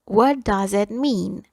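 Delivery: slow, carefully spaced teacher model; slow dictation pace; slow and clear. Shown as a demonstration. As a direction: spell it out slowly for the class. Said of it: "teacher repeats slowly for learners".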